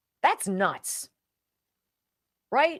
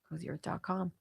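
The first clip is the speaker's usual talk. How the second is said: whispered to herself while checking; 11 dB quieter than usual. Real, not acted. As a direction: mutter the address under her breath. Checking the URL aside.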